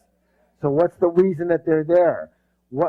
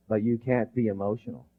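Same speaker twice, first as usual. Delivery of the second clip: usual speaking voice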